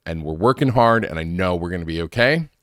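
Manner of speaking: ordinary talk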